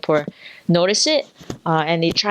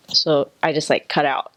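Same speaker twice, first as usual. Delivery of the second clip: ordinary talk, nothing marked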